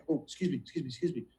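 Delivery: excusing himself from dinner quietly; hushed excuse me. Retold or imitated. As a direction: slip away from the table, quietly. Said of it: "I go".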